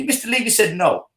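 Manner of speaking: usual speaking voice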